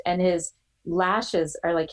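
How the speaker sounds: ordinary talk, nothing marked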